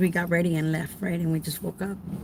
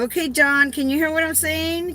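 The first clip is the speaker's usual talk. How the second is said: raised, higher call into the next room; yelling to John; 7 semitones higher than usual. Retold or imitated. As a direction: call out so John can hear. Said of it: "I like yelling anyways".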